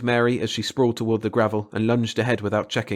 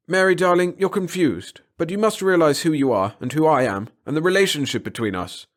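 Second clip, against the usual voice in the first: urgent coaxing; 7 semitones higher, 5 dB louder than usual. A narrator's reading. Professor pressing toward Mary.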